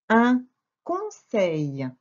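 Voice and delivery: "Un conseil" very slow, split into pieces; slow dictation. Shown as a demonstration. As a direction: dictate "Un conseil" with clear gaps. Teacher dictates the word slowly.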